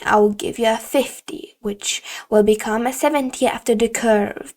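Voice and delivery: teacher threatening to report a student to the principal; strict teacher warning. Acted. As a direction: lay down the law firmly. Teacher granting lenient grade.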